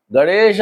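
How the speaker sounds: गणेश with a long "a" held after the sh; sounded final vowel. Shown as a demonstration. Teacher showing pupils the ending of गणेश, sounded final vowel. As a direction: stretch गणेश into "ga-ne-shaa". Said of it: "आ रहा है ना आ".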